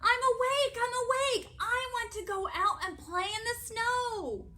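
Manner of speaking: high, loud, eager little frog; high-pitched excited clamour; crying out. A narrator's reading. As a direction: insist loudly like a pleading child. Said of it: "cried Froggy".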